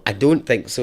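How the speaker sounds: ordinary delivery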